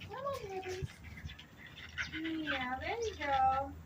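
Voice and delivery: high, soft coaxing. Real, not acted. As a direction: coax them out gently.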